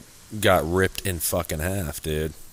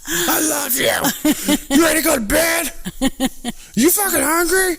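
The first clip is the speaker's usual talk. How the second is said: very high screamed small talk; mock yelling; 5 dB louder than usual. Joking that they yell whenever they talk to their partner, screechy mock yell. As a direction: scream tender everyday words as a joke. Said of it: "I always yell at her anytime I talk".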